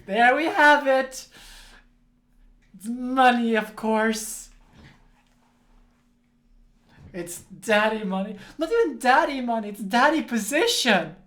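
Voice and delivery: loud, exasperated outburst; annoyed rant. Real, not acted. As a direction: vent at it, hitting daddy hard.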